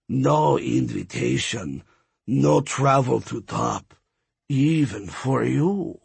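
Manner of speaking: slow, raspy skeleton voice; deep raspy voice. A narrator's reading. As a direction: drag the words out, rasping. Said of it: "Flacco said".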